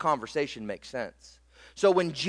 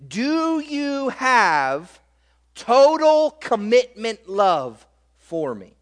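slow, higher, pleading question; voicing Jesus; 5 semitones higher, 4 dB louder, slower than usual. Jesus asking Peter for total commitment, slow and higher. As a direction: stretch it out, searching. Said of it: "what Jesus asked Peter".

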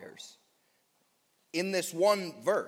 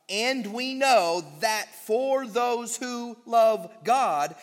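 slow and louder, leaning on know; slow weighted reading; 4 semitones higher, 5 dB louder, slower than usual. Acted. Preacher rereading the verse for emphasis, slow. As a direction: plant each word of the verse.

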